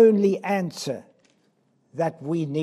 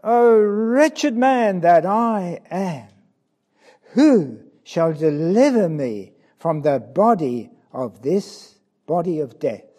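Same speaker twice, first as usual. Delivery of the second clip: crying out; 6 dB louder than usual. Acted. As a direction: let the cry tear out of him. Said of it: "and cried out".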